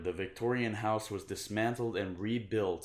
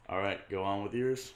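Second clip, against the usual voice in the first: ordinary delivery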